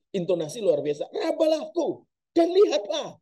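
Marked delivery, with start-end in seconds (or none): 1.1-3.1 high, insistent, fed-up invitation; Jesus to doubters, irritated; exasperated. Acted. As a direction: press them to touch and see. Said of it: "Gemes ini".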